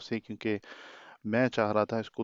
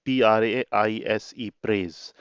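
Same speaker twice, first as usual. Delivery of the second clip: ordinary talk, nothing marked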